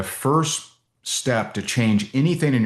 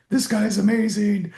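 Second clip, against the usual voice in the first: high, gushing awe; starstruck; 12 semitones higher than usual. Retold or imitated. A fan gushing over the speaker, high mock awe. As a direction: gush like a dazzled fan. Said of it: "You're like".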